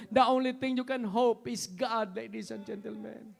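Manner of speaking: shouting, crying preacher climbing to a roar; impassioned sermon building to a shout; full-force preaching. Acted. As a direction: preach it with everything, rising to a cry.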